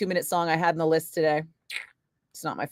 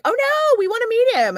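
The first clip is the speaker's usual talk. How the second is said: much higher, sing-song fake sweetness; mock sugary fake-nice; 4 dB louder than usual. Teller mocking the hotel guests who doubted her, fake-sweet and high. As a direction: mimic their phony eagerness, pitched way up.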